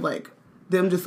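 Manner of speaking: ordinary delivery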